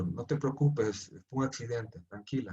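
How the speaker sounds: put-on calm a scared child after an accident; tender reassurance. Father soothing frightened daughter. (padre calmando con ternura a su hija asustada, voz suave)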